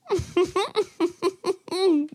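high airy chuckle. Real, not acted. Laughs at the meat joke.

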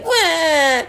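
high mock wail; pretend crying. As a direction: fake a child's bawling. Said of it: "loudly he started crying".

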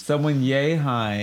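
yay high said slowly; slow, drawn out. Shown as a demonstration. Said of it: "shows height".

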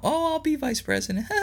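high, sing-song mock of a politician switching sides; mocking Harris. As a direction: sneer it in a prissy mock voice. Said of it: "She called him a racist and then now".

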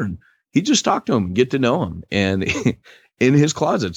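ordinary delivery

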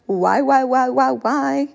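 drawn-out slow whiny why; mock whining why. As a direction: whine it like a pity party. Mimicking self-pity whine.

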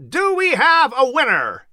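high, carrying call to the crowd; hammed-up shout. A narrator's reading. Announcer calling to the cheering crowd, high and grinning. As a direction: shout it out with a broad smile. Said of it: "he shouted with a broad smile".

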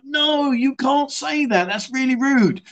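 high, slow scandalised objection; high slow mock protest; slow shocked protest. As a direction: drag it out, horrified. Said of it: "And I'm like".

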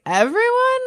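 high, incredulous "Everyone?"; shocked question. Retold or imitated. Retelling her shock at hearing everyone did coke, incredulous. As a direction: jump way up in disbelief.

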